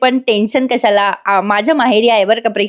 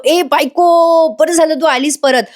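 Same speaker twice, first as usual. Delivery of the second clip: slow, higher, loving call to wife; fond greeting; 5 semitones higher, slower than usual. Acted. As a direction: draw out बायको with relief. Arjun hugs returning wife. (परत आलेल्या बायकोला मिठी मारताना प्रेमळ हाक)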